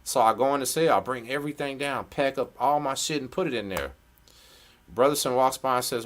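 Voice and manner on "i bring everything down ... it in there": ordinary delivery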